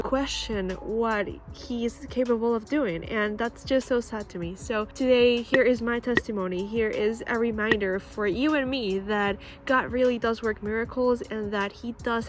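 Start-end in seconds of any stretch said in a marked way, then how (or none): none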